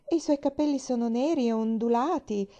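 high, delighted admiration; admiring exclamation. A narrator's reading. Girl praising the woman's eyes and hair, admiring. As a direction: gush over each feature with lifted pitch. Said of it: "esclamò Pollyanna".

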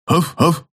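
high little yapping woofs; yappy little dog barks. Acted. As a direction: bark like a small pup. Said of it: "поддержала их средняя голова".

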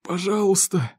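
high, strained begging; hoarse plea. A narrator's reading. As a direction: beg through the pain. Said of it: "прохрипел Беннет". (с мольбой, через боль)

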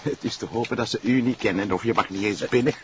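usual speaking voice

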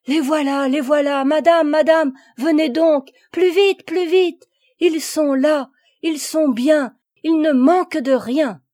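high, loud, breathless calling; excited shouting. A narrator's reading. Passerose shouting to Agnella to hurry, high loud excitement. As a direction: yell for madame to come quickly. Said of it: "cria Passerose".

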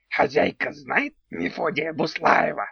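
odd creaky little house spirit; goblin voice. Acted. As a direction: play a quirky house goblin, slowly.

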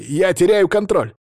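high, loud cry of alarm; exclaiming. A narrator's reading. Gorodetsky losing control of the air bubble, exclaiming. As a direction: cry out as your grip slips. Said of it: "воскликнул Городецкий".

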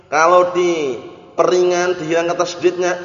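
normal talking voice

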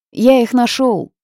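slow, loud, high triumphant cry. A narrator's reading. House spirit announces his find, loud and proud. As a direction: proudly shout the news.

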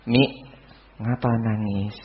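soft, low question to a crying mother; gentle child to mother. Shown as a demonstration. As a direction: ask mum quietly and tenderly. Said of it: "Lihat umimu nangis". (anak bertanya lembut kepada ibu)

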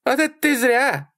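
very high female cry; high-pitched shout; shouted taunt. A narrator's reading. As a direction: call it out to the driver. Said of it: "крикнула Салли".

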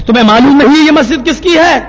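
high, loud demand; imitated angry challenge. Mosque man challenging the outsider, angry shout. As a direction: raise the voice indignantly.

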